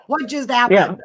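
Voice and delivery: high, loud, laughing question; incredulous and laughing, voice jumping up; laughing disbelief. Retold or imitated. Laughing repeat of her reaction.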